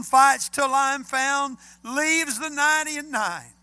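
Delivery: preaching at full force. Acted. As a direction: belt the song words out like a preacher in full cry. Preacher quotes song lyrics.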